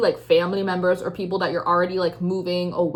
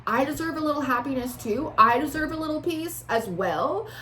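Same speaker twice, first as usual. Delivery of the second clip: higher-pitched, exasperated appeal; pleading protest; 6 semitones higher than usual. Acted. Querent asking the reader to tell them, high exasperated plea. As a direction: plead your case for a little rest.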